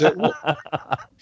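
a laughing "what?", caught off guard. Real, not acted. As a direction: laugh through the words in disbelief. Laughing at surgeon's request.

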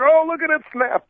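high gleeful gawking cry; excited. Retold or imitated. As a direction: relish the gruesome replay. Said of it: "Everyone's like".

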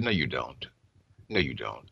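hushed retold pastor. Pastor's retold firm reply.

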